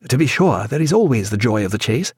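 ordinary delivery, nothing marked

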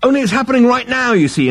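fast, very high, loud urgency; feverish insistence. A narrator's reading. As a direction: frantically press the point. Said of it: "insisted Zaphod feverishly".

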